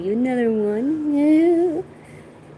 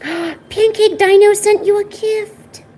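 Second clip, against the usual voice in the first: excited gasp; 9 semitones higher, 5 dB louder, slower than usual. Real, not acted. Gasps at gift announcement.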